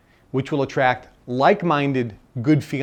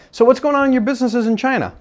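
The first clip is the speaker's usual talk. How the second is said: casual question; 10 semitones higher, 5 dB louder, faster than usual. Retold or imitated. Asking the billionaire at dinner.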